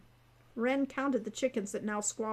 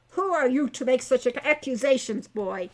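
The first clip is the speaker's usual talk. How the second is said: louder, furious centaur outburst; 7 dB louder than usual. A narrator's reading. Heinrich the angry centaur turning on the young intruder, furious. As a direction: bellow the accusation back at her. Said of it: "he said furiously".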